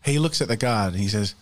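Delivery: ordinary talk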